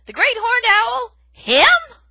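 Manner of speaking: very loud, high incredulous cry; astonished. Acted. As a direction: exclaim in disbelief. Aerith astonished.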